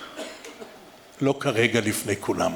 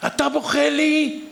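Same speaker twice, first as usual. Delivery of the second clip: inner outcry; 3 dB louder, slower than usual. Retold or imitated. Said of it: "אני מדבר עם עצמי".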